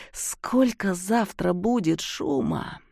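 ordinary delivery, nothing marked

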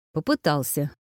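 short, low-key grumble; understated grumpy admission; grumbled. A narrator's reading. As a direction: mutter it grudgingly. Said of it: "буркнула Вэл".

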